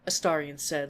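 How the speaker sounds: plain reading voice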